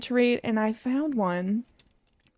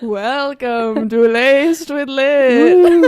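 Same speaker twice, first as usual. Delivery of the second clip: very slow, louder, half-laughing ghost intro; spooky ghost voice; 3 semitones higher, 10 dB louder, much slower than usual. Acted. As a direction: drag it out spookily through giggles. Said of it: "I did not sound very spooky".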